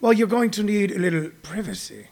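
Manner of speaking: soft, put-on British voice; softly mock a genteel British speaker; British accent. Comic imitating a British person needing privacy, British accent. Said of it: "like, a British person go".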